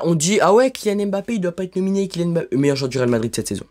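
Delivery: ordinary delivery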